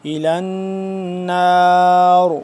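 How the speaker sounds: slow النار with a full heavy ر and a stop at the end; heavy ra with pause. Shown as a demonstration. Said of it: "را کو پر کر کے پڑھیں گے اور آخر میں وقف". (النار میں را پر، آخر میں وقف)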